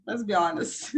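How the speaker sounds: normal talking voice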